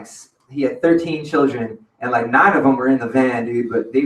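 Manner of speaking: normal talking voice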